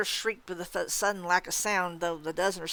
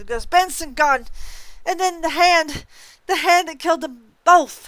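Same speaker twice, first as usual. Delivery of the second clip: high, loud, slowing frantic scream; screaming ghost; 10 semitones higher, 10 dB louder than usual. Acted. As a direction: shriek it, dragging the last words. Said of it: "she screamed".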